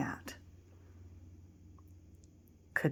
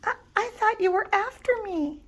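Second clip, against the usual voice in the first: nervous little wolf; 13 semitones higher than usual. Acted. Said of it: "still a bit scared".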